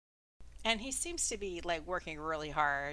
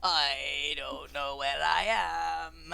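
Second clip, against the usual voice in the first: creature voice; 3 semitones lower, 5 dB louder, slower than usual. Acted. Creature voice lost.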